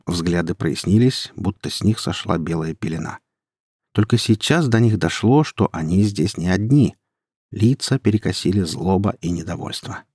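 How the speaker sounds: ordinary delivery, nothing marked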